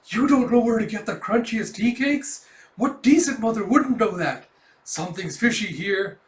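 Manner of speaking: loud, high, scandalized questioning; accusing. Acted. Imagined woman judging Yor as a mother, accusing. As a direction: grill her like a suspicious neighbour.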